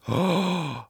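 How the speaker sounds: big delighted intake of breath; sharp, audible gasp of wonder; excited gasp. Acted.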